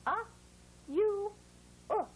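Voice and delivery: "uh", "yoo", then short "oo" as in "put"; three separate vowel sounds with pauses. Shown as a demonstration. Teacher modelling U's sounds, separated vowels. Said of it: "And U says".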